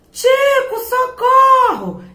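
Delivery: high, loud, drawn-out cry for help; shout for help. A narrator's reading. Little rabbit cries out to Tico, loud and high. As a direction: call out for help, stretching socorro. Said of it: "gritou a coelhinha".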